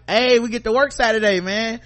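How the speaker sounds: high, loud fake enthusiasm; mock cheerful. Joking to coworkers about working Saturday, mock cheerful. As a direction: sell the joke with over-the-top cheer. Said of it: "they knew I was joking".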